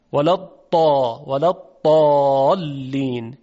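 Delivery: ولا الضالین with ض said as a heavy emphatic dal, Egyptian style; slow Egyptian-accent الضالین with a dal sound; Egyptian Dad. Shown as a demonstration. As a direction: Egyptian reading, ض like a thick d.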